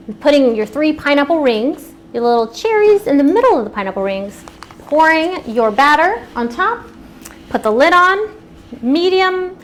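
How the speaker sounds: usual speaking voice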